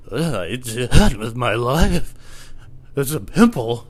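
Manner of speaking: hammed-up verge-of-tears whimper; near tears. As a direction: act drained and about to cry. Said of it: "on the verge of tears".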